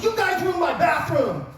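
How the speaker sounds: high, outraged yell in an owner's voice. Retold or imitated. Pizza owner pounding on the window: high outraged yell. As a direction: shout it at the coach through the window. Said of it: "he's yelling at you".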